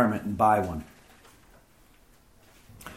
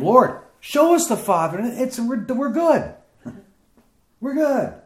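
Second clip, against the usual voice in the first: higher, casual, chuckling disciple; eager Philip; 11 semitones higher than usual. Acted. Preacher voicing Philip asking Jesus, light and amused. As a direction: toss it off like a satisfied follower.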